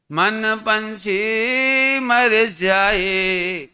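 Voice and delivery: drawn-out, chanted line of verse; slow verse recitation. Acted. Preacher lovingly reciting a line of devotional verse, very slowly. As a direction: savour each word of the couplet.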